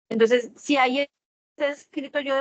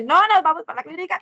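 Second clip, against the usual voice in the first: higher, louder insistence; firm mother; 7 semitones higher, 5 dB louder, faster than usual. Retold or imitated. Mother insists to hurt daughter they go to clinic. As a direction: put your foot down as mom. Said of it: "le dije".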